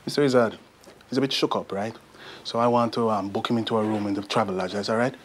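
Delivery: put-on brisk Jamaican-accented report; easy, matter-of-fact Jamaican voice. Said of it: "I can do a Jamaican accent".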